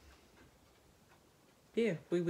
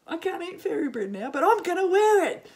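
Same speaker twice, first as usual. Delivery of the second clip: much higher and louder, delighted cry; excited squeal; 10 semitones higher, 11 dB louder than usual. Retold or imitated. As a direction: squeal like a kid spotting fairy bread. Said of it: "I saw this and went".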